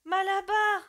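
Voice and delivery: high, loud desperate cry; voice of catastrophe. Acted. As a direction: scream his name in despair. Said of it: "d'une voix de catastrophe".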